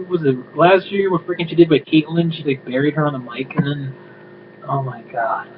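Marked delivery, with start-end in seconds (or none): none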